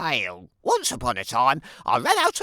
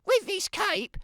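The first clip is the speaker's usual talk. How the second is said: put-on much higher, sing-song girlish voice; a sweet, self-admiring child's voice; vain little girl. Little Red voiced by narrator.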